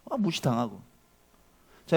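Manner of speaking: ordinary delivery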